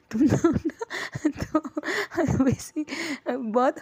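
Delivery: can't keep from laughing while talking; real laughter. Real, not acted. Narrator laughing at her brother's joke about mom, real laughter. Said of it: "ये मत समझे कि मैं हंस रही हूँ".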